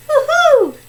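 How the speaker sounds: high whoop; excited cheer. Acted. Player two hearing the right answer, excited. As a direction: let out a high woohoo.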